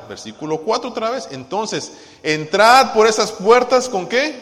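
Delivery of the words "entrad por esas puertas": loud, high, slow proclamation; leading scripture reading. Real, not acted. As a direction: proclaim it for all to follow. Leading congregation in reading psalm.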